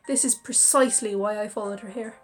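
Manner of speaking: ordinary delivery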